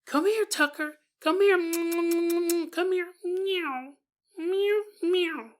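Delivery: high cooing come-here call; high mock meowing call; coaxing the cat. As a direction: coax the cat in with a high coo. Calling cat to bedroom.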